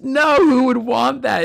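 high, loud, drawn-out incredulous "no"; mock indignant refusal. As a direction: imitate an appalled refusal. Student refuses the salt water.

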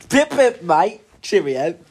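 chirpy put-on British accent; mock British accent. Friend taking a turn at being British, hammed-up British accent. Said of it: "Why do you sound like that?".

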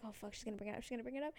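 very soft panicked inner voice; retold nervous muttering to herself; hushed dread. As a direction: fret under your breath.

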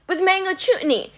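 high, eager improvising; pleading Grover. A narrator's reading. Grover tempting the Cyclops, high nervous offer. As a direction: pitch it up, grasping at an idea. Grover desperately inventing a recipe.

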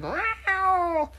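very high, loud cat yowl; shrieking storybook cat; screeched cat meow. Acted. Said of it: "screeched Fluffy Cat".

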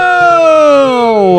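big high whoa; mock whoa. Host landing in the past, big high mock whoa. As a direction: ham up the time-warp shock. Pretend time travel arrival.

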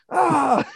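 a hammed-up cartoon scream; mock pain scream.

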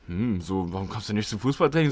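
low, mock-reproachful question. Retelling two friends quizzing him about training, reproachful. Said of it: "So richtig vorwurfsvoll".

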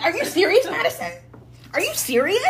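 high, incredulous retold challenge; confronting sister. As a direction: imitate a sister demanding an answer.

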